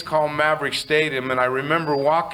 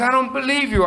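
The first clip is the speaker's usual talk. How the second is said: exasperated friend, rising; 9 semitones higher than usual. Retold or imitated. Art repeats it, more insistent.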